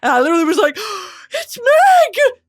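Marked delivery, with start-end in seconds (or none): 0.8-1.3 high, sharp mock gasp; big reenacted gasp of recognition; astonished gasp. Retells astonished movie-watching reaction. 1.3-2.4 very high, loud reenacted squeal; hammed-up tearful fangirl shriek; thrilled recognition.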